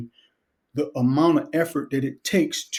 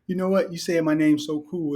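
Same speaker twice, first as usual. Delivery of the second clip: ordinary delivery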